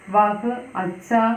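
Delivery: very slow, careful Hindi; teacher dictating a Hindi phrase slowly; slow model phrase. Shown as a demonstration. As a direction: dictate it word by word. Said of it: "ഈ സെന്റൻസുകളുടെ പ്രത്യേകത നോക്കിയേ".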